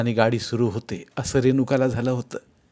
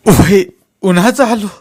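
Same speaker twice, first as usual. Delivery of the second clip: high, loud anguished reply; on the verge of sobbing; 9 semitones higher, 11 dB louder than usual. A narrator's reading. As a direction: cry it out in distress. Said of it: "ओक्साबोक्शी रडू लागला". (रडवेल्या, व्याकुळ आवाजात)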